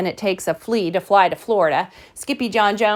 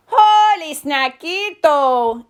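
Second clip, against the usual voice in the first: high, loud, stretched-out delight; slow, relished exclamation; exclaiming; 7 semitones higher, 5 dB louder, much slower than usual. Acted. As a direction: crow it like an excited kitten. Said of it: "exclaimed the kitty boy".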